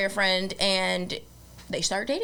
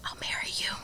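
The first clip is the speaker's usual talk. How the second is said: whispered; 7 dB quieter, faster than usual. Retold or imitated. Reluctant bride's quiet reply.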